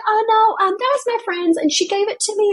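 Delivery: put-on high, quick little-girl fib; fibbing little girl. Mother imitating her daughter's nervous lie, high and fast. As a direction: squeak out the excuse like a five-year-old.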